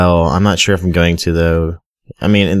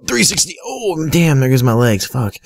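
high startled yelp at the fall; startled high cry; 8 semitones higher than usual. Real, not acted. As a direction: caught off guard, squeaking. Falls in game.

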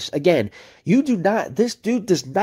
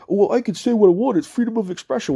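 higher mocking whine of his excuse; mocking impression; 6 semitones higher than usual. Streamer mimicking a rival's freedom-of-speech excuse, mocking whine. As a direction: play his smug excuse back at him. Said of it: "and then turns around".

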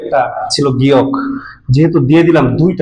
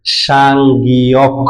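slow demo; much slower than usual. Shown as a demonstration. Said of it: "এই অক্ষরটার উচ্চারণ হবে".

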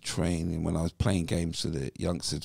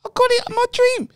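very high, loud excited shout, reenacted; acted elation at full pitch; 11 dB louder than usual. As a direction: shout the big news down the phone. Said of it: "something of excitement".

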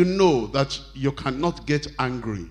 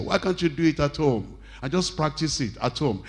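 ordinary delivery

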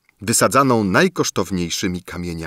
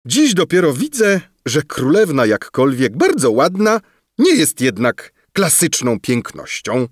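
Bulbo's catty remark; 7 semitones higher, 3 dB louder than usual. A narrator's reading. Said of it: "szepnął do swej tancerki".

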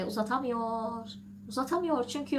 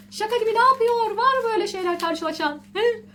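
high, loud disbelieving exclamation; real astonishment, much higher and louder; astonished; 10 semitones higher, 7 dB louder than usual. Real, not acted. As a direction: cry out in shock at the absurdity. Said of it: "Anlatırken şaşkınlık içindeyim".

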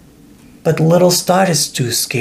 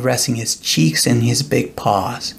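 plain reading voice

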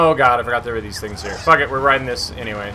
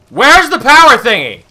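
higher, louder frustrated demand; exasperated shout; 8 semitones higher, 8 dB louder than usual. Real, not acted. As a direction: call out in real frustration. Still hunting for game item.

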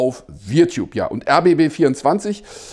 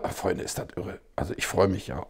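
whisper; 11 semitones lower, 13 dB quieter than usual. Real, not acted. Marvelling aside to viewers.

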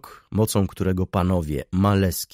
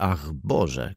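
usual speaking voice

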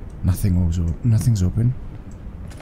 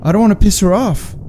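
scared horror game streamer; 8 semitones higher than usual. Real, not acted. Accidentally pushed the ghost girl.

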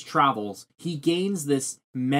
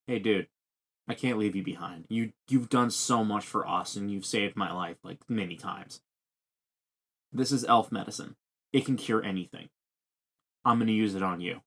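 lower, softer retold plea; low gentle plea; 5 semitones lower, 6 dB quieter, faster than usual. A man telling his wounded friend he won't leave him, low and gentle. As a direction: quietly voice a reassuring friend. Voicing Judo's quiet farewell plea.

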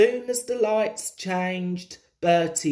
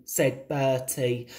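usual speaking voice

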